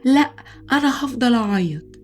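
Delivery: put-on defiant child. Boy refusing to stop crying to his mother, defiant.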